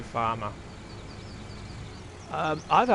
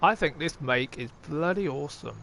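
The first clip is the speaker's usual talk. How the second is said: usual speaking voice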